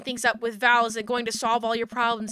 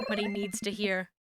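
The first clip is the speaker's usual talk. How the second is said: soft, half to yourself, much softer; quiet murmur; 4 semitones lower, 9 dB quieter than usual. Real, not acted. Player murmuring over the game's dialogue choice, quietly. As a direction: mumble it under your breath.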